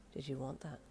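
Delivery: soft, teasing question. Acted. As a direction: tease him quietly. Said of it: "he asked teasingly".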